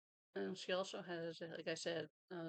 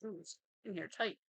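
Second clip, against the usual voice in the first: usual speaking voice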